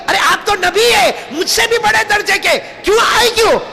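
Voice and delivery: high, excited exclamation of surprise; the saint leaps up amazed a prophet came; surprised saint. Acted. (حیرت بھری اونچی آواز)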